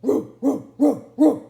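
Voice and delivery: loud roof roof barks; Bottomley Potts barking. Acted. Dog barks loudly up at the cat. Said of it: "said Bottomley Potts".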